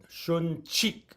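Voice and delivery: "shun, chik" with a puff of air on the ch; aspirated ch. Shown as a demonstration. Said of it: "an apostrophe that's aspirated".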